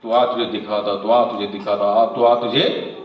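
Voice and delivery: low, repetitive taunt of someone picking a fight; mock bully threat. Bully scaring us every day, low mock threat. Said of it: "कोई बंदा हमको रोज डरा रहा है".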